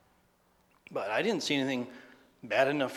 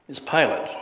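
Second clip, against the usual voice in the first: ordinary talk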